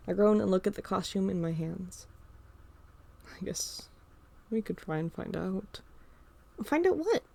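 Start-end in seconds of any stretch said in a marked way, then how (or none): 3.3-5.8 hushed, breathy thinking aloud; whispered to self. Acted. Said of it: "mumbled to myself". 6.6-7.2 put-on high, rising little-boy question; curious kid brother. Carl entering the room.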